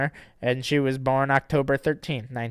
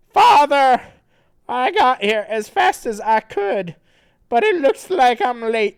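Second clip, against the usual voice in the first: character voice; 12 semitones higher, 11 dB louder than usual. Acted. Reading Sonichu's lines.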